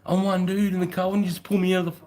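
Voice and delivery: high, put-on protesting voice; retold protest. His own retold protest.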